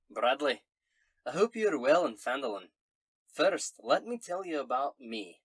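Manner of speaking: hearty Scottish letter-writer; Scottish accent. Acted. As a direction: read the letter aloud in a Scots brogue. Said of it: "in a Scottish accent".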